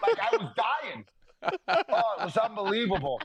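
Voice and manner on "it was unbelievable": normal talking voice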